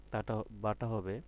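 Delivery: ordinary talk, nothing marked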